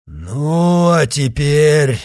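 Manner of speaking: loud, slow, menacing growl; growling werewolf alpha. A narrator's reading. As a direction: loom over them, threatening. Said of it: "окинул их злобным взглядом новый вожак".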